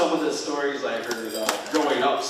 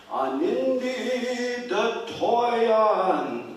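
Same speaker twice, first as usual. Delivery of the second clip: eerie, long-drawn spirit call; high, loud chant stretched very slowly; old strange voice; 10 semitones higher, 5 dB louder, much slower than usual. A narrator's reading. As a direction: wail it out like a spirit calling through woods. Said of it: "this old strange voice go".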